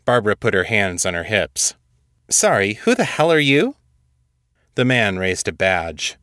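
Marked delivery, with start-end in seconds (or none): 2.3-3.8 high-pitched, affronted demand; indignant challenge. A narrator's reading. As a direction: demand to know who they are, pitched well up. Challenging agents come to arrest her.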